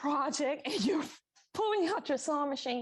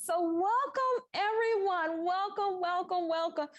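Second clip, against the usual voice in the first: excited welcome; 8 semitones higher than usual. Real, not acted. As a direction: greet everyone with real excitement, drawing it out. Host opening the workshop.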